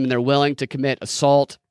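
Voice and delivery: ordinary talk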